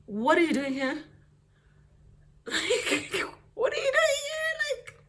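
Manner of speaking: high, loud, rising shriek of disbelief; shocked disbelief. Retold or imitated. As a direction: yell it like you can't believe he's there. Shocked at boyfriend at door.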